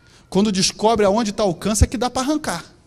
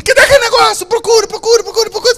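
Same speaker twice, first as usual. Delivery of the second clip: much higher, louder hammed-up husband; imitating the husband; 12 semitones higher, 9 dB louder, faster than usual. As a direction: bark the order like a pushy husband. Husband voiced demanding item.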